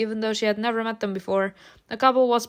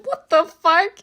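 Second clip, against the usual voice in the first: outraged; 8 semitones higher, 5 dB louder, slower than usual. Acted. Mother raging at psychologist.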